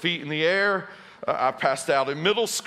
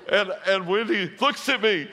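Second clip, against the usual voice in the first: normal talking voice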